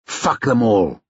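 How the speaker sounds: ordinary delivery, nothing marked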